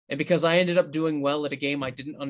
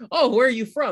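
bright curious question; 9 semitones higher, 6 dB louder than usual. Retold or imitated. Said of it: "And I'm like".

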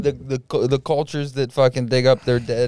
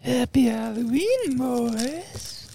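high, slow cartoon voice; cartoon character voice; 11 semitones higher, 5 dB quieter, much slower than usual. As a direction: play a goofy cartoon man. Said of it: "almost like the dude from Family Guy".

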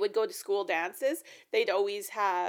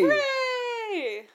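excited cheer; 13 semitones higher, 4 dB louder than usual. Real, not acted. Cheering together.

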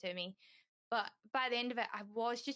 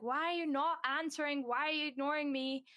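retold exasperated questioning, pitched up; insistent, mildly frustrated demand for an answer; frustrated pushing; 5 semitones higher, 4 dB louder than usual. Dad pushing his unresponsive child for answers, frustrated. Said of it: "he's still trying to push".

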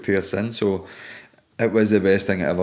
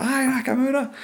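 excited reply. Retold or imitated. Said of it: "I was like".

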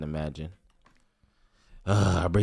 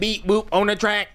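alien beeping; 9 semitones higher than usual. Pretend. Alien beats joke.

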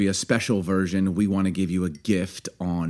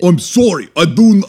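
put-on high, louder go at a Russian accent; attempted Ukrainian-Russian accent; 9 semitones higher, 10 dB louder than usual. Host apologising to offended friend, failed Russian accent. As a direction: botch a Slavic accent, pitched up. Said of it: "I can't do it".

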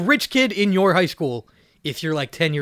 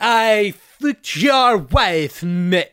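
slow, drawn-out bad Australian accent, a little louder; a deliberately awkward Australian accent; slow mock Australian; 3 semitones higher, 4 dB louder, much slower than usual. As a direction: stretch every word in a clumsy Australian accent.

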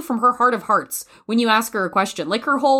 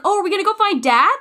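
put-on excited boy; 11 semitones higher, 3 dB louder, faster than usual. Boy's excited question.

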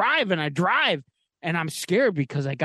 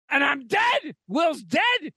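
mock dramatic death; 10 semitones higher, much slower than usual. Dramatic death punchline of story.

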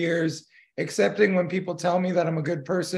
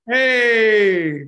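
loud playful hey; 3 semitones higher, 7 dB louder than usual. Real, not acted. Mock protest.

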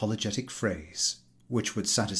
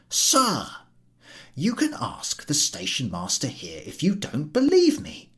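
higher, aggrieved official voice; fussy ticket collector; 6 semitones higher, 3 dB louder than usual. Acted. Ticket collector complaining to the station master, aggrieved. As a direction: plead his case to the station master. Podyakin addresses the man.

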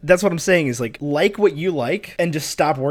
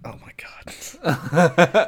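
whispered laugh; 2 semitones higher, much slower than usual. Real, not acted. Cringing laugh.

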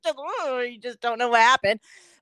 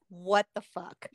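startled mutter; 4 semitones lower, 9 dB quieter than usual. Retold or imitated. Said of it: "When it happens to me, I'm just like".

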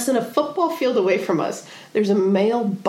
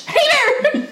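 hollering to scare bears away; nervous hiker shouting hey bear over and over; frantic bear yell. Retold or imitated. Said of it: "you're supposed to yell".

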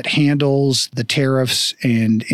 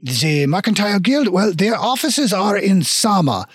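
put-on hammy French accent, hesitating over directions; bad French accent. Dwarf shopkeeper directs visitors to the guild, bad French accent. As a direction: stilt dwarf with mock-French lilt. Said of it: "very shitty French accent".

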